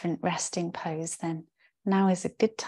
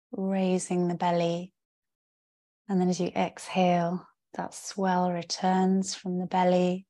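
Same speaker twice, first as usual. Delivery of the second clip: guided meditation. Real, not acted. Yoga teacher settling the class into rest, calm guided-meditation voice. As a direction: guide them into rest, with spacious pauses between phrases. Leads a yoga nidra practice.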